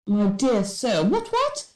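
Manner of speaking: mock English gent, ending very high; hammed-up English toff, squeaking on the catchphrase; too-English impression. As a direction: overdo the English gentleman. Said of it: "almost like a step far too English".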